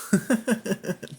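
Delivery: short high-pitched chuckle; real giggle at one's own confusion; amused laugh. Real, not acted. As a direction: break into a quick, high laugh.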